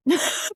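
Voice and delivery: high, loud squawk of a laugh; a sudden bird-like squawk. Real, not acted. Laughing at her cohost's question with a squawk. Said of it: "didn't mean to squawk like that".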